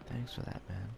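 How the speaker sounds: imitating a soft, muted thanks; barely-there imitation of a quiet hero; quietest voice. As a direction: drop right down for a mock-humble thank you. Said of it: "in the quietest voice".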